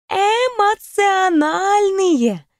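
медленно тянет слово, лукаво; растянуть слово со значением; протяжно. Acted. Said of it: "протянула она". (лукаво растягивая слово)

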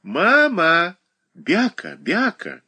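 put-on high little girl voice; a small girl calling from behind the sofa; child's babble. A narrator's reading. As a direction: babble like a toddler. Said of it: "из-за дивана послышался детский лепет".

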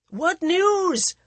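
high, loud royal shout; shouting Maharaja. Acted. Maharaja shouts to his huntsmen for news, loud. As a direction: call out to the riders for news. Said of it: "the Maharaja shouted".

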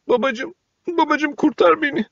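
put-on high, desperate child's plea; pleading. A narrator's reading. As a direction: beg for your father to save you. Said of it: "diye yakarmış".

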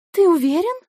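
mumbled into his chest; muffled, face buried in chest. Acted. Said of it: "пробормотала она, уткнувшись ему в грудь". (бормочет, уткнувшись в грудь)

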